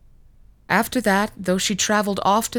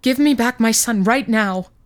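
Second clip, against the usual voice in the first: louder, higher furious demand; angry demand; 5 semitones higher, 5 dB louder than usual. Acted. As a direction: order him to hand the child back. Mother demands her son back.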